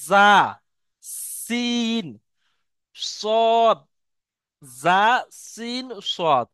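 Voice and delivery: জা, সিন, সদ hissed with the tongue on both teeth; crisp sibilant letters; Arabic letter demo. Shown as a demonstration. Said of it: "দুটো দাঁত".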